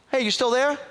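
high-pitched check on the line; calling out. Retold or imitated. Caller checking on a silent phone girl, calling out.

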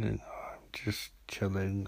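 whispered, half awake in bed; drowsy whispered aside; sleepy whisper. Real, not acted. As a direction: breathe it out, barely awake. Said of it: "I'm barely awake".

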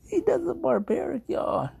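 very high, squeaky laughing voice; helplessly amused, pitch shooting up; cracking up. Real, not acted. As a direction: squeal it out after a laughing fit. Breaking up over the superhero joke.

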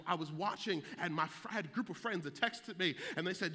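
ordinary talk, nothing marked

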